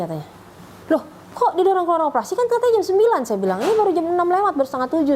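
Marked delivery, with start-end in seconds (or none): 0.9-3.2 high-pitched, disbelieving retort; startled protest. Retold or imitated. Mother on the phone to her child about the early operation, startled protest. 3.6-5.1 raised-pitch objection down the phone; startled protest. Retold or imitated. Mother telling her child it is too early, startled protest.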